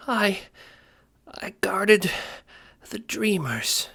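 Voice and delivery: high, fading, slow last breath; dying words. Acted. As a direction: give the last words with pride. Ace dying.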